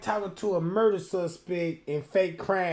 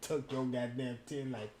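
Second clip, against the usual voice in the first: usual speaking voice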